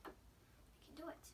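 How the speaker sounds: slow whisper "We can do it"; whispered pep talk. Real, not acted. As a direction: whisper "do" slowly to yourself. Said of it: "come on".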